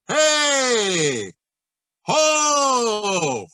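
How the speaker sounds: high ringing call from the back of the mouth; practice shout. Shown as a demonstration. Teacher demonstrating shouts to students, high back-placed call. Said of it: "a couple of shouts".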